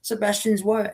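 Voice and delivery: high excited fan squeal; starstruck gush. Retold or imitated. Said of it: "I'm like".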